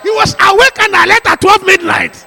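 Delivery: loud, high preacher's shout; preaching at full force. Acted. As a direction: drive it home with full fire.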